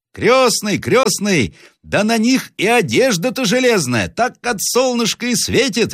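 put-on very high, excited boy's voice; a young lookout calling down his report; мальчик с колокольни. A narrator's reading. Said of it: "отвечал с колокольни мальчик". (высокий мальчишеский крик)

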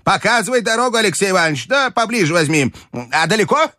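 put-on high-pitched old lady giving orders; high, brisk matriarch; imperious grandmother. A narrator's reading. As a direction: boss him along in a high voice. Grandmother commanding the narrator.

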